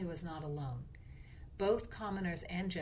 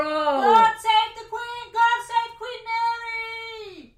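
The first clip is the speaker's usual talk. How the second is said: cheering crowd shout; 15 dB louder, much slower than usual. Pretend. Mock crowd cheers the queen.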